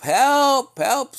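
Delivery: high, loud imitated call for help; acted cry for help. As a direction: cry out like someone stranded in a flood. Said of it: "you're crying for someone to help".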